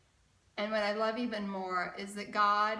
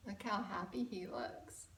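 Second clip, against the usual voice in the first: plain reading voice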